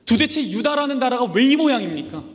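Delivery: high, loud exasperated complaint; the prophet venting at God, loud and high; complaining cry. Acted. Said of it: "하박국 선지자가 이렇게 물어보는 거예요".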